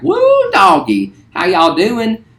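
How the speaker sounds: high, slow, hollering West Virginia twang; West Virginia accent. Retold or imitated. Teacher shows the class his West Virginia childhood voice, hollering high. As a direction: whoop it out like a West Virginia country boy. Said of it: "this is how I used to talk".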